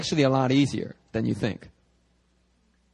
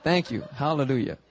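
ordinary delivery

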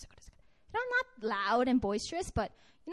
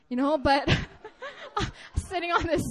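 laughing; 4 semitones higher, 7 dB louder than usual. Real, not acted. Laughs at own hurt.

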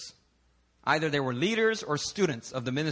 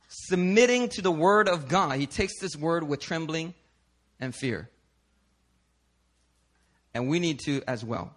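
ordinary talk

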